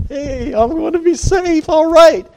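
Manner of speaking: high, stretched-out cry of glee; excited. Acted. Rescued man jumping in the boat, excited. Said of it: "I get so excited".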